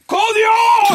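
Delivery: put-on high, loud drunken cheer of a name; drunk fan yelling. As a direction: bellow it like a hammered fan at a gig. Heckler shouting his name again.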